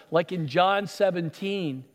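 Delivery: normal talking voice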